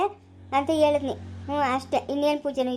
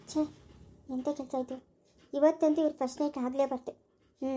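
quiet plotting to oneself; softly, keeping the plan private; scheming aside; 2 semitones lower, 7 dB quieter than usual. Acted. Schemer plots alone.

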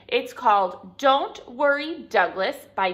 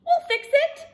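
high, loud chipper sheep voice; helpful sheep; 6 dB louder than usual. A narrator's reading. Sheep reassuring Douglas about his hat, high eager voice. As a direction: pipe up high and eager to help. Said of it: "said the sheep".

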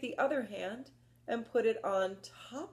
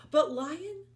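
usual speaking voice

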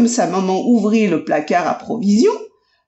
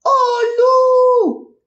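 high cry fading out; 3 dB louder than usual. Acted. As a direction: shriek then trail off. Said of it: "il criait". (cri aigu qui retombe)

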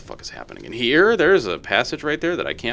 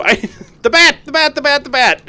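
high shouts broken by laughs; shrieking at a bat while laughing; laughing panic yell; 7 dB louder than usual. Real, not acted. Yelling about the bat.

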